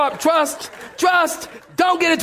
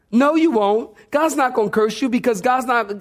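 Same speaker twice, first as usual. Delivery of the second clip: ordinary talk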